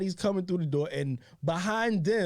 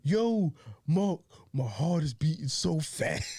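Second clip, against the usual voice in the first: slow, mildly panicky retelling; a touch of the stoned kid's fear; mock paranoid brother; slower than usual. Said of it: "like, paranoid".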